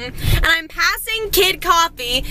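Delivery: ordinary talk, nothing marked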